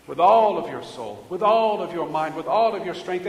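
"all" hit loud at each repeat; stress on ALL. Retold or imitated. Preacher quoting Jesus's commandment, stress on "all". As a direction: punch the word "all" in every phrase. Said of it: "using the word all for emphasis".

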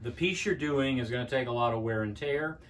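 ordinary talk

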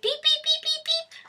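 high squeaky mock bleep sounds; censor bleep imitation. Shown as a demonstration. Imitating the bleep viewers will hear, high squeaky beeps. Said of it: "all you're gonna hear is beep".

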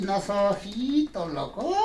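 normal talking voice

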